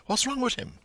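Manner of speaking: high, sing-song Dublin query; Dublin pub question. Acted. McCoy asks about Paddy Dignam, high pitched. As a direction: ask it like a gossip in a pub. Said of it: "McCoy asked the question".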